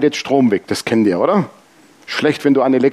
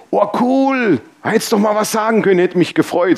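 mock delighted surprise; 6 semitones higher than usual. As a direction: imitate an impressed friend, pitched high. Spielt erfreute Reaktion des Bekannten. (gespielt begeistert, hohe Stimme)

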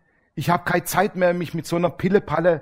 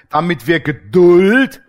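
Geduld drawn out slowly and louder with a rise; stress on Geduld; 7 dB louder, slower than usual. Shown as a demonstration. Preacher reading Romans to the congregation, Geduld stretched and loud. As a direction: lean hard on Geduld as the dreaded word. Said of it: "das böse Wort wieder".

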